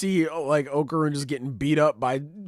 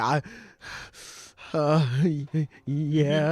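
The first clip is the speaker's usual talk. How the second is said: nervous stammer; 4 semitones higher, slower than usual. Retold or imitated. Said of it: "He's like".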